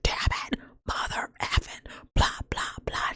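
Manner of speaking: raspy, unvoiced bird swearing; cussing parrot. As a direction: imitate a parrot cussing under a harsh whisper. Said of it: "the bird was cussing like a sailor".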